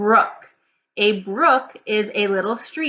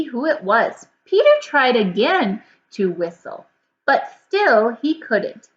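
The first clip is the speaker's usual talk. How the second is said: put-on lively, louder storybook reading to children; story read-aloud; 3 semitones higher, 4 dB louder than usual. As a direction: read it with big expression for kids. Reads the story aloud to class.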